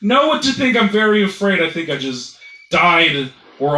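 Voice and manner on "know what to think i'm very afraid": hammed-up fearful whine; very afraid. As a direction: act out a frightened inner voice. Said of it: "my mind was like".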